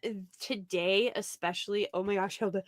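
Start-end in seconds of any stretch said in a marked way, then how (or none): 1.9-2.6 talking on the edge of a sneeze; breath catching before a sneeze; about to sneeze. Real, not acted. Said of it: "Sorry, I sneezed".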